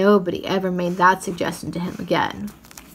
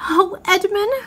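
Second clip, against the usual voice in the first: tearful cry; 11 semitones higher than usual. A narrator's reading. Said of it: "and began to cry".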